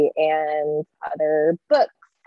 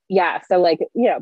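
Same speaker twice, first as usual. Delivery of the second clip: normal talking voice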